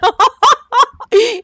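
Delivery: loud, high relieved laugh; nervous laugh. Real, not acted. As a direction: laugh off the fright. Lachen nach dem Schreck. (nervöses Lachen)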